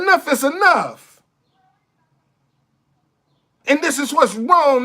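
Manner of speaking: ordinary talk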